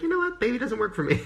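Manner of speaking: mock a snooty judge of the baby, laughing; mock critic voice. Vlogger mimicking a critic who dislikes her product, high mock voice. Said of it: "and they're like".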